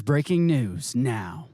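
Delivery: slow, low, grave anchor voice; news reporter. Retold or imitated. Host mimics a TV reporter breaking news, slow and low. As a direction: announce it like a serious TV anchor. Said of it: "a news reporter comes on and says".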